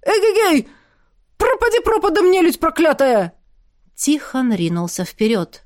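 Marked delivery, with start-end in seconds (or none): 0.0-3.3 much higher shouted war cry; battle cry. Acted. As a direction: charge in roaring. War cry charging with sword.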